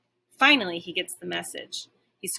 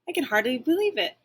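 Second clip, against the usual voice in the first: ordinary delivery, nothing marked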